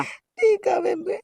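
high, slow tearful sob through the words; crying. Acted. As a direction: choke the words out while crying. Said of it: "ਰੋ ਨਾ।".

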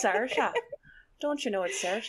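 put-on lilting Irish accent, ending on a rise; mock Irish accent. Co-host correcting the name in fun, playful Irish accent. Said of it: "I love a good Irish accent".

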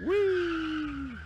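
very high, loud, long whee; mock gleeful "whee". Cheering a crash on screen, gleeful high squeal. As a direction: squeal as if on a ride. Mock glee at spinning car.

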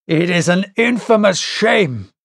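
a mildly voiced indignant outburst; indignant cry. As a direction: cry out the shame, lightly angry. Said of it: "cried Ned Land".

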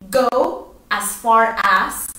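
slow, careful teaching read; slow model phrase. Shown as a demonstration. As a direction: Go as far as said very slowly. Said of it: "vaya hasta tal avenida".

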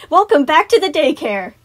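high, very loud welcoming call; Sun, enthusiastic. A narrator's reading. Sun welcoming children back to the daycare, enthusiastic. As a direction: throw big enthusiasm into the welcome. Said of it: "putting as much enthusiasm as he could muster".

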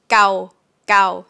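gao short and crisp. Shown as a demonstration. Teacher repeating short gao for students. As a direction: clip the vowel in gao. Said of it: "Short version".

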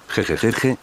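ordinary delivery

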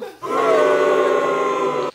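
loud shrieking burst of laughter. Real, not acted. Loud laugh, called aggressive.

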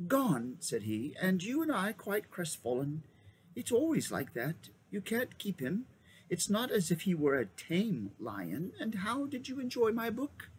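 put-on high, kindly old wizard; a lightly voiced old magician. Magician speaks.

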